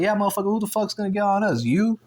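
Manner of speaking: retold angry challenge. Speaker defending his brother from a stranger, angry challenge. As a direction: throw the threat back, riled up. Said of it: "'Cause now I got mad".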